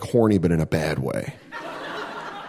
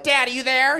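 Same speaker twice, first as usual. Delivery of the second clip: higher, pleading son voice; son calling out; 9 semitones higher than usual. Pretend. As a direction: call up to dad hopefully.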